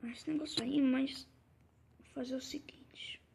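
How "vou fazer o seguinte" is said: hushed whisper. Real, not acted. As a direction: whisper the plan. Quietly planning.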